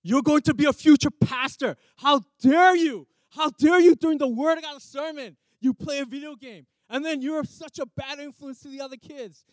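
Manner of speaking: loud, high scolding shout; furious mentor yelling at an intern; angry pastor's rebuke. Retold or imitated. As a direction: blast him like an outraged boss pastor. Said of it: "and he says".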